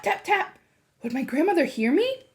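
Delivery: ordinary delivery, nothing marked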